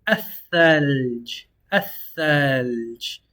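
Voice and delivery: الثلج as ath-thalj, slow, no lam; نطق بطيء لكلمة الثلج; careful Arabic word. Shown as a demonstration. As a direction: say الثلج slowly with a doubled tha. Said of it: "we have".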